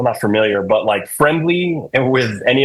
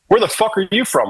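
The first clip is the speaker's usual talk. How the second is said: higher-pitched mock interrogation; retold hostile challenge; 7 semitones higher than usual. Eric grills the dude in the band, mock hostile challenge. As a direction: imitate a rude grilling without shouting. Acts out friend's hostile questioning.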